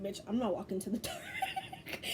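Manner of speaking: high, laughing mock refusal. Refusing the dark door, laughing.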